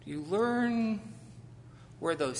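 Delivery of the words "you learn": usual speaking voice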